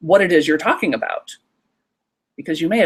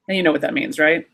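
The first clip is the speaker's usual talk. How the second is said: ordinary delivery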